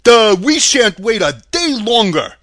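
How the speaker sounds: loud, goofy straw-man voice; dim Scarecrow. A narrator's reading. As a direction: blurt it out as a lovable dimwit.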